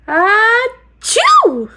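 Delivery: high, loud fake sneeze. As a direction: let out a big sneeze. Bear sneezes.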